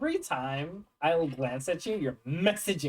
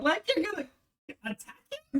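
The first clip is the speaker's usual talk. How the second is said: mock disbelief, much higher; incredulous friend; 12 semitones higher, faster than usual. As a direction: squeak out the disbelief. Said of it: "He was like".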